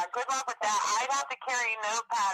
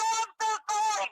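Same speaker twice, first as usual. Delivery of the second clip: embarrassed apology; 11 semitones higher than usual. Retold or imitated. Caller apologising for the wrong name, higher and flustered. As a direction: blurt the apology, mortified. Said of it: "I go".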